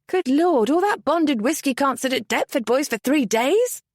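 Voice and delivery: put-on a young widow aghast at the news; Merritt, alarmed. A narrator's reading.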